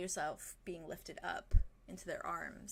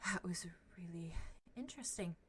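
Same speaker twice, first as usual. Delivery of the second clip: awed whisper; 5 dB quieter than usual. Acted. NPC after the vision.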